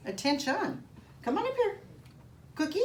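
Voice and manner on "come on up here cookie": coaxing the dog. Real, not acted. Calling the dog up.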